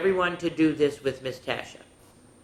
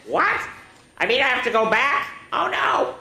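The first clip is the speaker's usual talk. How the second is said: much higher, squeaky mock horror; dismayed dog; 10 semitones higher, 3 dB louder than usual. Stanley realizing school is tomorrow, mock dismay, much higher. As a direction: play the pup's cartoon dread.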